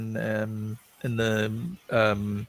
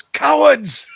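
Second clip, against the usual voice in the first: loud, high-pitched outraged shout; imitated scream; 13 semitones higher, 11 dB louder than usual. Retelling a director's reply about using CGI, screamed in outrage. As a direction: yell it out like a scornful accusation. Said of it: "Well, he screamed".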